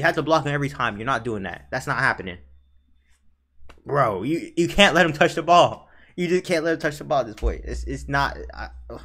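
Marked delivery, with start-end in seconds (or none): none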